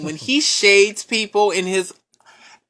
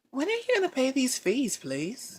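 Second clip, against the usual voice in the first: soft-spoken collector; 4 semitones higher, 12 dB quieter than usual. Pretend. Debt collector calling about unpaid fees, hushed and polite. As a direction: politely press for payment, softly. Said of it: "someone was calling you".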